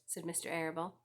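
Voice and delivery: usual speaking voice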